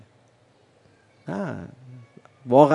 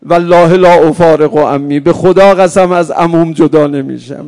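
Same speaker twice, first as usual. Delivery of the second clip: put-on the boy's vow, shouted at full force; cried out; 16 dB louder than usual. A narrator's reading. Preacher voicing the orphan clinging to his uncle, shouting. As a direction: scream it in grief, refusing to let go. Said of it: "فریاد زد".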